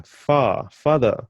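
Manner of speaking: "Fa", "Father" with a clear f; f sound. Shown as a demonstration. Teacher linking fa to English father. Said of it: "Then we've got the F sound".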